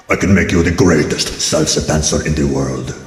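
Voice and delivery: very low, ominous tempter voice; dark, deep pitch-man from hell; devil's bargain. Acted. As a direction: make a sinister offer, deep and deliberate.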